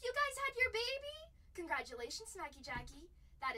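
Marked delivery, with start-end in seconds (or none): 0.1-1.2 high, loud gasp of surprise; thrilled disbelief at the news; surprised. Real, not acted.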